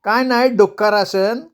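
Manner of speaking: nervous high guess; high-voiced villager. Messenger answers Uma.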